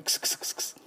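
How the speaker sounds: quick "クス" repeated like a snicker; giggle-like "ks". Shown as a demonstration. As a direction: say "クス" as a voiceless, repeated ks. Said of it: "クスクス笑う時の".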